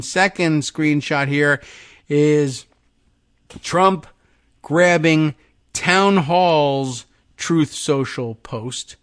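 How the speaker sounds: ordinary talk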